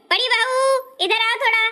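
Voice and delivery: high, carrying summons to the daughter-in-law; summoning call. Acted. Mother-in-law summons elder daughter-in-law.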